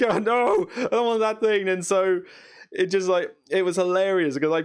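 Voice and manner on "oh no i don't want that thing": higher, laughing mock horror; laughing mock fright. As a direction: recoil from the spider while laughing. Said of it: "It's like".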